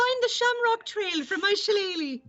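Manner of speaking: very high, hammed-up stage Irish; mock Irish accent. Actress plugging herself as the radio ad, mock Irish accent. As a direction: squeal it like a silly Irish promo.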